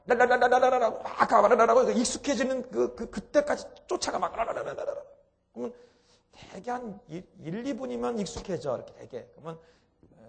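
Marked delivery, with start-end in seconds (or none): 0.1-5.0 tongue babble. Shown as a demonstration. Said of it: "혀로만".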